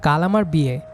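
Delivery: ordinary delivery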